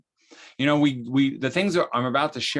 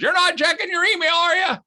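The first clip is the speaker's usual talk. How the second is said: high, louder suspicious call up the stairs; yelling from upstairs; 5 dB louder than usual. Retold or imitated. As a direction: call out to your husband from another floor. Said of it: "my wife would yell from upstairs".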